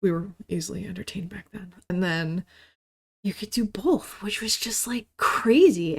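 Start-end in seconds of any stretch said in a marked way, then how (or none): 3.2-6.0 whisper. Real, not acted. Awe at phone plus internet.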